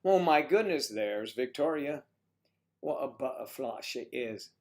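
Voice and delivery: ordinary delivery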